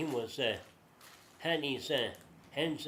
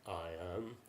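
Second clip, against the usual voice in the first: low, soft, acted "I am"; quiet, solemn answer; 8 semitones lower, 10 dB quieter than usual. Jesus answering Pilate, low and quiet. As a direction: answer softly and low, after a pause.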